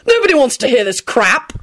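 heated, contained outburst; furious shout. A narrator's reading. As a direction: complain angrily. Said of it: "shouted furiously".

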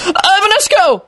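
very high, frantic spell cry; wild shout. Acted. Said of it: "Arthur shouted wildly".

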